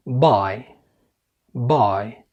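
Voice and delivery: "باي" as English bye with voiced b; correct B sound. Shown as a demonstration. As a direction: model "باي" with the b as in Arabic. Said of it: "صح، ده صوت ال ب".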